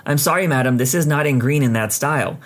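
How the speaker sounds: usual speaking voice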